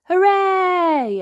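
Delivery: high, loud hooray; cheer. Acted. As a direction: kids cheering at the party. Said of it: "everyone cheered".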